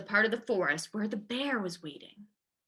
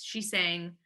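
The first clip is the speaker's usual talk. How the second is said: ordinary delivery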